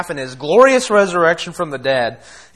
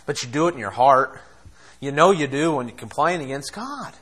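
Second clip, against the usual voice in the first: usual speaking voice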